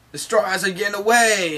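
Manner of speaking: high, urgent cry of alarm; alarmed shout. Retold or imitated. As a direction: raise the alarm. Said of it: "they're like".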